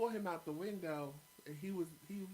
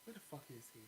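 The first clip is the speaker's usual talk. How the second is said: worried mutter; 2 semitones lower, 10 dB quieter, faster than usual. Retold or imitated. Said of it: "I'm like".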